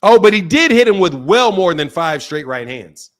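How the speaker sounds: normal talking voice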